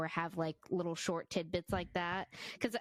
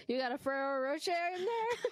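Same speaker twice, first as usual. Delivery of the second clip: mock nosy coworker; 10 semitones higher, faster than usual. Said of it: "I hear someone throwing away foil".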